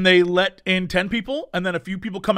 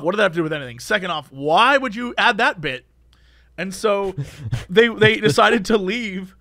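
ordinary delivery

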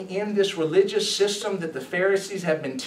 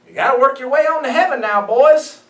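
high, loud, quick rallying cry in jest; mock exhortation; 7 semitones higher, 9 dB louder than usual. Preacher parodying what Jesus isn't saying, mock exhortation. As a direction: egg them on loudly, pitched up, as a parody. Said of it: "This isn't Jesus saying".

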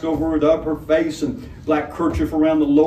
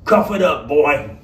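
lightly voiced menacing robber; a hint of a gruff robber, easy threat; 4 dB louder than usual. Robber commands Matthew.

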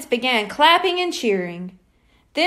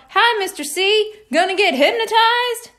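yelled; 8 semitones higher, 3 dB louder than usual. Acted. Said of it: "Someone yelled".

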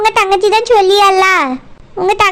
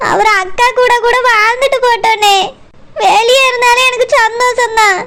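normal talking voice

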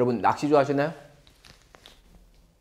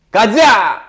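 cheerful shout; 6 semitones higher, 9 dB louder, much slower than usual. Pretend. Teacher acts a call to go.

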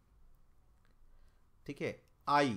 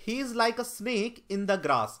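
slow emphatic question reading; 6 semitones higher, 5 dB louder, much slower than usual. Shown as a demonstration. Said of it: "reading the question".

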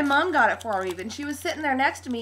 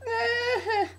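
high, tearful laugh-sob; real overwhelmed sob of joy; squeaky sob, half laughing; happy sobbing; 10 semitones higher than usual. Real, not acted. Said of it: "sobbing I R L".

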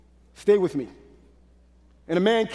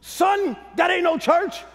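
higher mock stern father; a hint of a scolding dad; imitating daddy; 6 semitones higher, slower than usual. Son retelling his father's scolding, higher mock stern. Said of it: "my daddy said".

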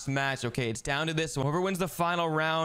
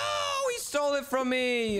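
very high shouted wail; outraged cry; 11 semitones higher, slower than usual. Real, not acted. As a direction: shriek in protest, then whine it out. Point stolen in the game.

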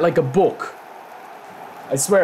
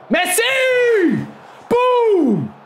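ecstatic goal shout; much slower than usual. Real, not acted. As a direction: scream the scorer's name. Goal celebration.

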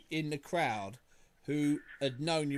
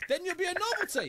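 lightly hammed-up posh English, higher; a playful put-on English accent; 13 semitones higher than usual. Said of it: "You up the English accent a little bit".

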